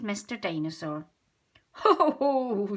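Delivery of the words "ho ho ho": louder, higher jolly ho ho ho; hearty mock chuckle; chuckling. A narrator's reading. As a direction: chuckle like a pleased dad. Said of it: "chuckles Daddy Pig".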